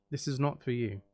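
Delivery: ordinary talk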